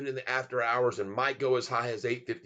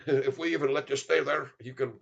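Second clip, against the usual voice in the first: put-on threatening hotelier with Indian accent; 2 semitones higher than usual. Hotel owner refuses a mocker a room, put-on Indian accent. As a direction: turn him away in a mock Indian accent. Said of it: "if they see you talking like this".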